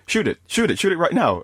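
high, pushy [name] egging him on; imitating [name] insisting he shoot; [name] urging. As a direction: egg him on, pitched well up. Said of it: "[name] hands him the gun and goes".

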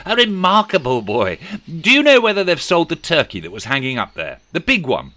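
high, loud eager Scrooge. A narrator's reading. Scrooge asking the boy about the turkey, eager and loud. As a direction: lean out and quiz the boy eagerly.

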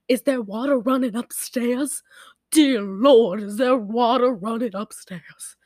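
slow, drawn-out movie mom impression; imitated mom. Mom asking about water running upstairs, imitated slow overacting. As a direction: play the overacting mother, dragging it out. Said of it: "that's how she acted, too".

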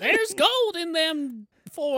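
put-on high, slow old-timey miner drawl; old prospector voice. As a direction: ham up a gold-rush prospector.

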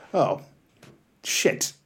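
soft, sinking mock dismay; muted dismay. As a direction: let it drop out under the breath. Acts dismay at being outed as comedian.

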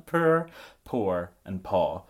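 "Purr, poor, and paw" with three distinct Scottish vowels; Scottish accent. Shown as a demonstration. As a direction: repeat them in a Scottish accent. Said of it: "Do them again".

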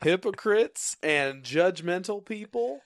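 slow, high announcer read; game show host. Pretend. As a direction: present it like a quiz show answer. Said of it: "I lost my game show announcer voice".

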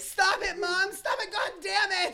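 high pitched frantic pleading; exasperated yelling. Acted. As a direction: shriek at mom to stop. Acted mother-daughter quarrel.

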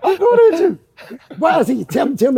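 high, loud pretend denial of anger; mock raging. Host calls him raging, comedian loudly denies it in mock anger. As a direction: bark it playfully, faking temper.

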